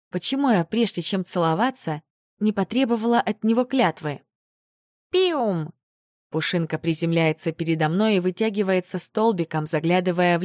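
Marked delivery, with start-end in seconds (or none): 5.1-5.7 high, falling little squeak; creature squeak. Acted. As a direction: chirp like a tiny animal.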